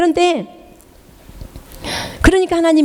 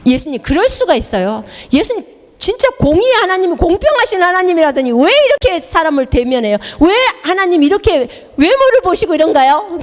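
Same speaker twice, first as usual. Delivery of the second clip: higher, aggrieved protest to Jesus; indignant complaint; 9 semitones higher than usual. Retold or imitated. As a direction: demand why Jesus is unfair. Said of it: "그 주님께 따진 거예요". (억울하게 따지는 목소리)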